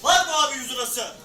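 loud calling for bids; auctioneer. Acted. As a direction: call out for a bid.

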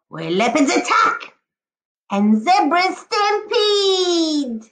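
lively, sing-song picture-book read-aloud; expressive storybook voice with a howl at the end; reading to children. Acted. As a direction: paint each jungle animal for the class. Teacher reads picture book aloud.

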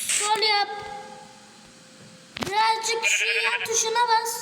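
excited high urging. Real, not acted. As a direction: push them to press it fast. Urging the player on the controls.

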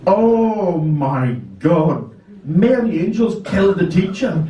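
slow, rising, horrified exclamation; aghast, slowly building disbelief; shocked. Retold or imitated. Pupils stunned by the assault.

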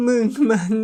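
high, loud tearful sob of a word; loud high tearful scolding; crying reproach. Acted. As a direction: choke it out through tears. Crying while hitting his back.

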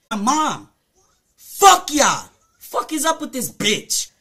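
angry curse. Real, not acted. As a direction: throw it out as an angry shout. Cursing out the commenters.

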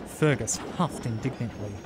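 usual speaking voice

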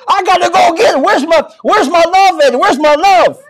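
high, urgent searching; an excited dog hunting for its person; eager dog's voice. Acted. Preacher voicing dog chasing his sister-in-law, high and eager. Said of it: "the dog ran after love".

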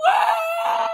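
long, high cartoon "wah" cry; hammed-up wail. Reader acting out hungry Betty crying, mock wail. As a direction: fake bawling at a very high pitch. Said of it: "Betty cried".

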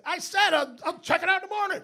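high, irritated call through the wall; grumpy roommate yelling back; mock yell back. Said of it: "We're yelling through a wall".

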